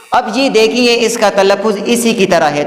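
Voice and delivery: normal talking voice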